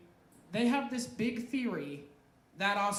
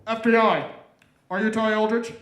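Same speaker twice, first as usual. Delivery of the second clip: very loud official announcement at usual pitch; FBI agent; 15 dB louder than usual. Acted. FBI agent confronting the actor at home, loud and official. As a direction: call it out as an agent at the door.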